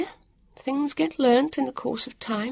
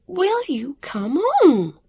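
impatient; 14 semitones higher, 5 dB louder, much slower than usual. A narrator's reading. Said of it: "the woman said impatiently".

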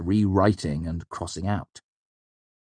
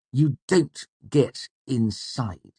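drawn-out words with raised pitch; slow, condescending; 7 semitones higher, much slower than usual. A narrator's reading. Clerk bars boy from archives, slow and condescending. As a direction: explain it word by word, patronisingly. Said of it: "as if explaining to a simpleton".

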